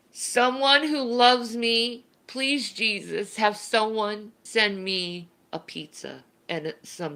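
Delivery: drawn-out, playful imploring; mock pleading prayer. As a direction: beg heaven for food, hammed-up. Mock prayer begging for pizza.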